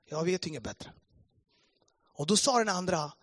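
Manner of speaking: normal talking voice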